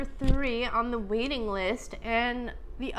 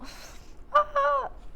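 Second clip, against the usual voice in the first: high gasp of overwhelmed laughter; happy tearful outburst. Real, not acted. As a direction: burst out through happy tears. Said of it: "I'm so happy".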